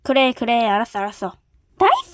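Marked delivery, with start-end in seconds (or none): 1.8-2.1 squealing high with delight; a sudden leap of joy; excited cheer. Acted. Rabbit kid cheers at eating alone, thrilled.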